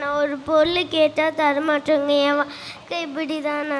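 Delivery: sing-song tearful lament; acted crying. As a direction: wail self-pityingly to the teacher. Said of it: "எதுக்கு அழுதுட்டே இருக்க நீ".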